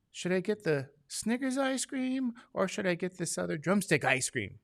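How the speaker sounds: lightly mocking his wife's voice; imitating wife. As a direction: mimic her sweet dithering ask.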